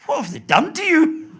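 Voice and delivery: appalled, very high and loud mock neighbour; Missus Whitehouse. Neighbour shocked at how he talks now, shrill dismay. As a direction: wail it in horrified falsetto. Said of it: "she came to see me, and she said".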